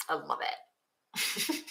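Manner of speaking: slow, soft breathy whisper of delight; hushed, dreamy repeat. Real, not acted. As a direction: breathe it out slowly, almost to herself.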